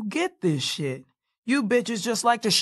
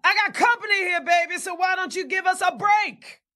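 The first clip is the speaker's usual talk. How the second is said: calling out; 12 semitones higher than usual. Acted. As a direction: shout through the house. Calling out to Wanda offstage.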